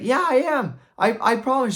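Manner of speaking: very high, loud mock protest; pleading insistence. Knight swears to wife he is trying, pleading insistence.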